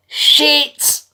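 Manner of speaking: high, very loud outburst; exasperated yell. Real, not acted. Customer ending a rant about live-chat agents, exasperated yell. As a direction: explode on the swear word at full pitch. Exasperated climax of repeated complaint.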